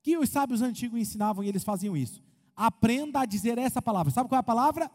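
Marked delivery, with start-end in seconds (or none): none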